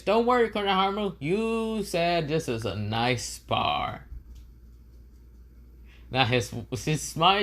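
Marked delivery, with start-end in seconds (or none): 0.0-4.1 Boruto. Acted. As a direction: reassure teasingly in a high voice, stretching the end. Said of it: "Boruto says".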